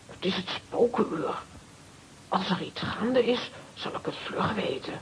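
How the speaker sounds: secret midnight whisper; tense whispered plotting; nervous whisper. Acted. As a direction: keep it hushed so nobody hears. Sidonia sneaking a call at midnight.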